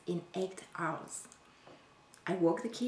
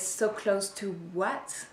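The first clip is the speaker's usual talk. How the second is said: slow questioning comeback, rising at the end; challenging question; slower than usual. Acted. Wife challenging her husband's promise, pointed questioning. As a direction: throw the words back as a pointed question. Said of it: "what I want to know is".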